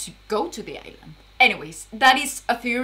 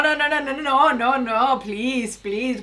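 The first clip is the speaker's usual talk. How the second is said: normal talking voice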